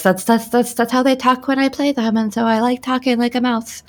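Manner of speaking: small, high mouse voice. Shown as a demonstration. Player shows the group her mouse character, tiny high voice. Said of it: "I do have a mouse voice".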